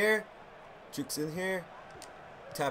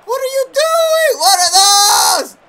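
frustrated shout; 11 semitones higher, 11 dB louder, slower than usual. Real, not acted. Yelling at teammates in game.

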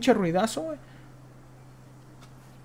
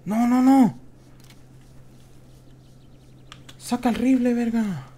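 higher, louder alarmed cries; 7 semitones higher, 3 dB louder than usual. Real, not acted. Streamer panicking under sudden fire, loud and high. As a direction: panic as the enemy appears.

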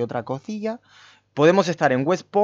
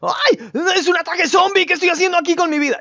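high-pitched mock panic; panicked victim; 14 semitones higher, 4 dB louder than usual. Naked survivor caught in a zombie attack, comically panicked. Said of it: "me gusta que sea así como".

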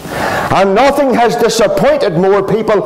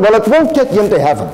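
usual speaking voice

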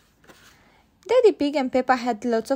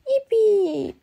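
high loud cheer; 9 semitones higher, 6 dB louder than usual. A narrator's reading. Peppa cheering about pancakes, high loud cheer. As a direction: cheer with delight. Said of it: "cheered Peppa".

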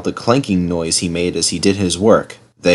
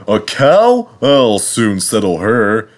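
lofty, drawn-out assurance; grand; slower than usual. A narrator's reading. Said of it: "he said grandly".

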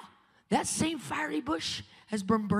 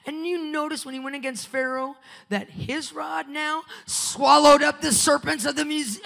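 ordinary talk

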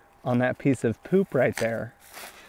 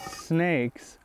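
normal talking voice

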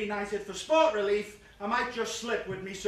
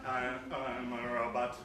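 mock robot; 9 semitones lower, 5 dB quieter than usual. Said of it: "I sound like a robot".

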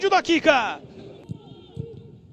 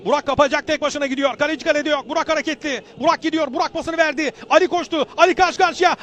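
ordinary delivery

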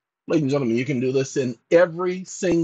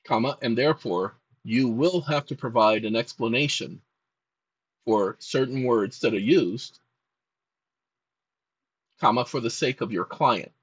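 lower, measured dictation with every comma spoken; 3 semitones lower than usual. Real, not acted. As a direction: read it out like dictating to a secretary.

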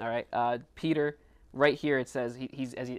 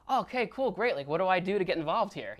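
higher, upbeat imitated eagerness; keen acceptance; 6 semitones higher, 3 dB louder than usual. The people react to Peter's speech, keen to join. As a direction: jump in as a willing recruit. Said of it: "Their reaction is".

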